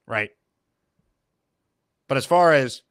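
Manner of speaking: ordinary talk, nothing marked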